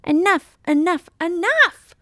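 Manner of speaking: high, loud screech; cat screeching enough. Acted. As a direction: shriek it out. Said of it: "Merle screeched".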